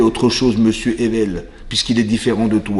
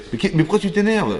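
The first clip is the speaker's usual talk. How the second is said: mock innocent host; 8 semitones higher than usual.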